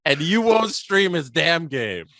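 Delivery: loud, high teasing scolding; hammed-up indignation, drawing out the words; mock outrage. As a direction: badger a friend in playful disbelief. Mock scolding Steve for not streaming.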